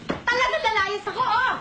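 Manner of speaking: defiant shout. Acted. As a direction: throw it back in her face. Daughter yelling back in quarrel. (pasigaw na pagsagot)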